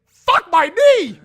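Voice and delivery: pained yell. Acted. Character kicked in the knee by Ernest, loud pained yell. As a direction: howl it as the kick lands.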